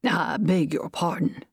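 ordinary delivery, nothing marked

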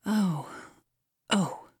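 mild, falling groans. A narrator's reading. Miss Matilda groans going back upstairs, mild groan. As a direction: groan to yourself twice. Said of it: "groaned Miss Matilda".

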